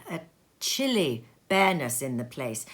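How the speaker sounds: plain reading voice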